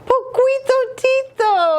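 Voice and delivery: very high-pitched delighted cry; excited exclamation. A narrator's reading. Scapito recognising his amigo, high excited exclamation. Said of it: "exclaimed Scapito".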